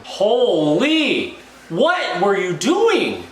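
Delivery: high, loud, slow outraged cry; exasperated shout. Real, not acted. Fan shouting at a player on the TV, exasperated. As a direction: drag it out in disbelief.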